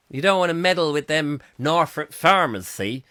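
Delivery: put-on a light West Country farmer accent. Warning listeners off the Norfolk farmers, in a light West Country accent. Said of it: "talk like that with a bit of, um, West Country accents".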